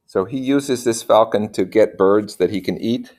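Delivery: plain reading voice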